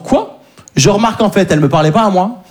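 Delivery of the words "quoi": high little questioning "quoi"; high, rising mock innocence; feigned innocence. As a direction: squeak it as if surprised. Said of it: "en disant".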